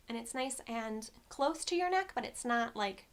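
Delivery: ordinary talk, nothing marked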